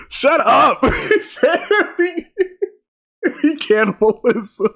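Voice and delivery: high-pitched laughing disbelief through giggles; cracking up at the joke, barely getting words out. Real, not acted. Streamer laughing at a viewer's cannibalism joke, giggling.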